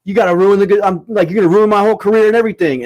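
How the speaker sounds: high, loud, fast protest; retold rant, voice climbing as the words tumble out; outraged accusation. Comic accusing his girlfriend of wrecking things, retold outrage.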